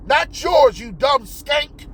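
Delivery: usual speaking voice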